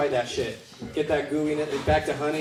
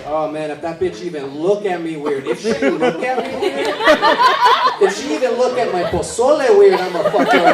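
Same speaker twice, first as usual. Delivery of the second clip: high, loud mock threat; mock furious auntie rant; aunt's threat; 3 semitones higher, 7 dB louder than usual. Aunt threatening while making pozole.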